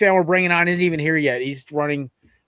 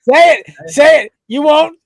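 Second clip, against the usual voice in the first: loud, high taunting dare; loud goading; 9 semitones higher, 7 dB louder than usual. Real, not acted. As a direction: dare them at the top of your voice. Host goads guest to say it.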